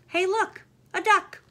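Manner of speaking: high, loud excited shout; thrilled high call of a storybook character; excited discovery. Acted. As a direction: burst out pointing at the duck. Book character spots duck.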